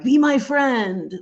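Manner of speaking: high, drawn-out needy plea; mock begging. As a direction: plead slowly like a clingy wannabe friend. Mocks begging people she'd be chasing.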